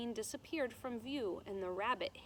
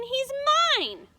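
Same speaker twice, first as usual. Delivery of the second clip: loud, high possessive cry; loud child's cry of mine; screaming; 12 dB louder than usual. A narrator's reading. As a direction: claim him, loudly and high. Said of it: "screamed Abilene".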